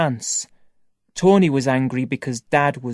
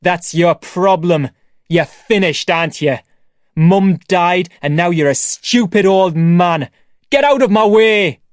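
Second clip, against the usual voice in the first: loud, scornful yelling at his father; angry shouting; 2 semitones higher, 6 dB louder than usual. Acted. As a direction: spit the insults out in a rage. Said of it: "Tony shouted".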